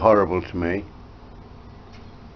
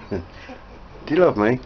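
cooing; 4 semitones higher, slower than usual. Real, not acted. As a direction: coo it to your pet. Cooing to the pet bird.

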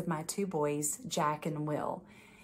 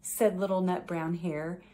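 gentle bedtime story read-aloud; reading to children. A narrator's reading. Judge reading a picture book to children, gentle storytelling.

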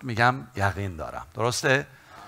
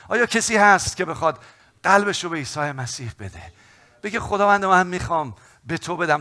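high, loud, impassioned altar call; fiery preaching; 8 semitones higher, 9 dB louder than usual. Acted. Preacher urging the church to pray, fiery and loud. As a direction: preach it at full force to the congregation.

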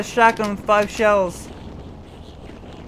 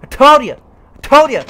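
gloating; 3 semitones higher, 8 dB louder than usual. Real, not acted. Gamer gloating after a win, building louder. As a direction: rub it in, more each time.

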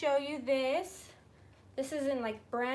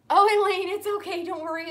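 louder, higher burst of reassurance; eager reassurance; 6 semitones higher, 11 dB louder than usual. Real, not acted. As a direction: rush to reassure a viewer. Reassuring a viewer in chat.

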